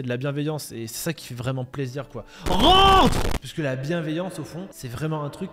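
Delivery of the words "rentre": shout. Real, not acted. Barked recall order.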